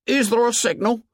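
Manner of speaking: high, worried question; anxious Piggy. A narrator's reading. Piggy anxiously asking Ralph about the signal, high and worried. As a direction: ask fearing the answer. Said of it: "said Piggy anxiously".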